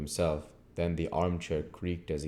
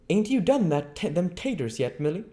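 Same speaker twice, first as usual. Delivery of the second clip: high, loud call to the maid; landlady calling to Milly; 13 semitones higher, 6 dB louder than usual. A narrator's reading. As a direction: shout across to Milly in a high voice. Mrs Hall calls to maid.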